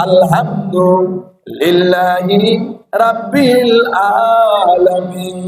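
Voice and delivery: আলহামদুলিল্লাহি রাব্বিল আলামিন half-sung slowly with Bengali sounds; Bengali-style recitation. Shown as a demonstration. Preacher mimicking how Bengali readers recite আলহামদুলিল্লাহি রাব্বিল আলামিন, slow half-song. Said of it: "যখন বাংলাতে পড়বে".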